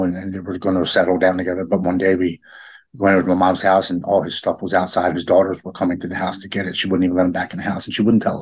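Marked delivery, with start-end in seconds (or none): none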